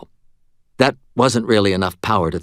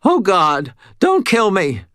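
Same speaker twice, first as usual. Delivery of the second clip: begging; 11 semitones higher, 4 dB louder, slower than usual. A narrator's reading. Cashier begs for life.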